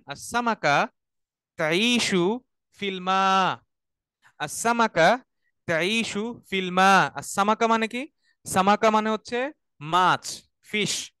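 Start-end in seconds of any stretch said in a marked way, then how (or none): 0.1-3.6 slow, drawn-out recitation for students to repeat; slow read-along. Shown as a demonstration. Teacher leading students to read along, slowly. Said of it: "আমার সাথে আপনারা পড়েন". 4.4-7.1 slow, drawn-out recitation for students to repeat; slow read-along. Shown as a demonstration. Teacher leading students to read along, slowly. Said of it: "আমার সাথে আপনারা পড়েন".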